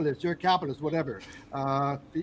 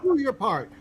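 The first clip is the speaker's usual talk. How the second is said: mock slogan; 8 semitones higher, 4 dB louder than usual. Mocking the slogan.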